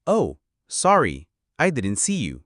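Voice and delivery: very slow "Oh, sorry" with a stretched "Oh"; slow example read. Shown as a demonstration. Teacher reading an example apology very slowly. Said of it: "أمثلة".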